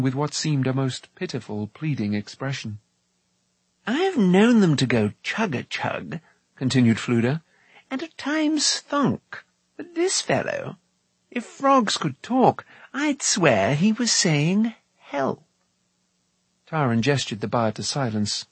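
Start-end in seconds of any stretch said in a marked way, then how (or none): 3.9-6.2 slow, high-pitched musing; wondering bard, pitched up; chatty puzzled bard. Acted. Voices the bard. 7.9-15.4 slow, high-pitched musing; wondering bard, pitched up; chatty puzzled bard. Acted. Voices the bard.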